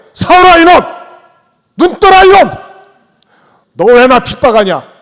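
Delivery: an angry god shouting down at Saul; furious rebuke. Acted. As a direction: roar at him like calling down fire. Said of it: "무섭게 얘기하고".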